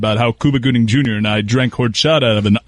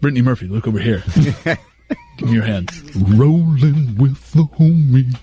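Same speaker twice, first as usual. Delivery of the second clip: Elton from Clueless. Retold or imitated. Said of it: "[name]'s fake Elton from Clueless".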